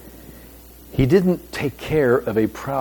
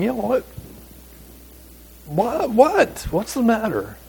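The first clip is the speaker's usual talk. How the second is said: high, lilting voice of the gardener asking what's wrong; gardener's gentle question; 10 semitones higher than usual. Retold or imitated. Gardener asks the weeping Mary what's wrong, high and puzzled. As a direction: lift it up high, puzzled and kind.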